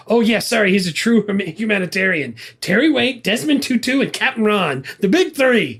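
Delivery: hammed-up sarcastic list of humanitarians. Husband sarcastically answering his wife about Captain Ron, mocking.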